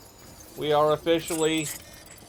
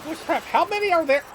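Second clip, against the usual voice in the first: high, fast amazed yell; amazed shout; 14 semitones higher, 6 dB louder, faster than usual. Real, not acted. Gamer spots a crowd of enemies, amazed high yell. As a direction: shout it out in disbelief, quickly.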